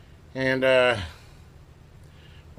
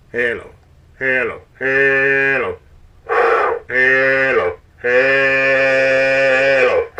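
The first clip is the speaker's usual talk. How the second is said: loud drawn-out mic check; 2 semitones higher, 11 dB louder, much slower than usual. Acted. Loud test calls into mic.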